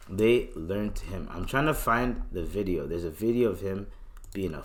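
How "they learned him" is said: a slow, ominous echo; slow, low repeat. Real, not acted. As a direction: let it sink in slowly. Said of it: "Well, they learned him".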